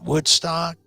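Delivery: ordinary delivery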